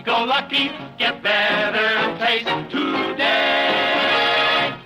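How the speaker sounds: ordinary talk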